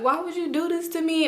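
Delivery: high, softly whining plea; retold crying. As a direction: imitate a tearful, wounded question. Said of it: "And I was just crying bad".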